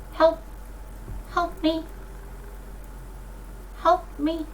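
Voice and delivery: faint, high little bird voice; a tiny bird calling for help, high and soft; quail. Acted. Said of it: "you hear a faint voice".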